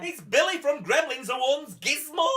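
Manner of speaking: high, loud thrilled announcement; mock excited audience member. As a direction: gasp it out like a starstruck fan. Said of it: "audience went".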